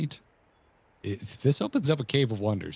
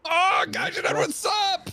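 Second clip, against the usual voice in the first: shouted greeting; much slower than usual. Pretend. Greeting a streamer.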